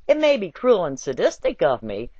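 ordinary talk

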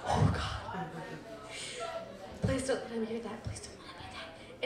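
whispered, hushed pleading; panicked whisper. Retold or imitated. Mom in the kitchen praying her husband didn't hear. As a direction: breathe the prayer under your breath. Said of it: "I'm just like".

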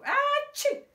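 high, loud explosive sneeze. Acted. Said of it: "She sneezed again, louder this time".

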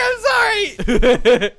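slow, high sorry yelled through laughter; laughing, drawn-out loud sorry; laughing apology. Real, not acted. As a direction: apologise while cracking up. Apologising after being caught cheating.

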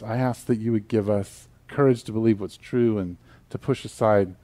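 hushed, earnest prayer voice. Acted. Opening prayer before sermon.

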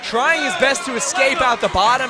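excited play-by-play. Real, not acted. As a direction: call the finish with rising energy. Play-by-play of the finishing ground strikes.

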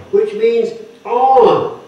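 "on" said slowly and loudly, hanging on the word; slow it down and land hard on "on"; teacher spelling out that "on" is the meaning; slow stressed gloss. Shown as a demonstration. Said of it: "the preposition there is epi".

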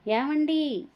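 high, heartbroken cry of alarm; wife cries out to her husband in anguish. Acted. The wife cries out to her husband, high and heartbroken. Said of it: "గుండెలు బద్దలు కొట్టుకుంటూ".

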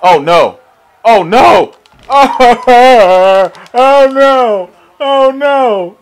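loud, high cries of alarm; alarmed shouting. Real, not acted. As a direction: cry out in dismay. Reversal in the match.

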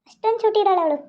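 ordinary talk

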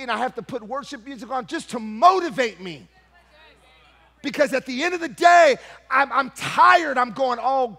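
loud, high, shouting sermon climax; preaching at full force. Acted. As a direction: cry it out to the church.

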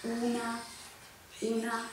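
stage whisper. Acted. Whispered game clue.

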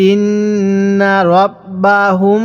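"ইন্না রব্বাহুম" recited slowly with long nasal hums on nn and final m; ghunnah. Shown as a demonstration. Teacher showing how to read it, "ইন্না রব্বাহুম" with sustained ghunnah. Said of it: "এজন্য এটা গুনাহ করব".

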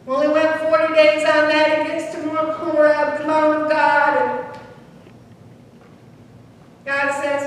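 ordinary talk, nothing marked

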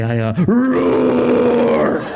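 long, slow drawn-out roar in a fake sick call; mock roar. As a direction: let a lazy roar stretch out mid-sentence. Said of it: "I got the roars".

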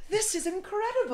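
lightly voiced, high sweet princess; a hint of sweet cartoon princess; Disney princess voice. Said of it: "you sound like a Disney princess".

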